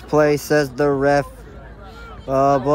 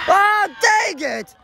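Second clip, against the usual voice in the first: frustrated shout; 12 semitones higher, 3 dB louder, slower than usual. Real, not acted. Opponent scores again.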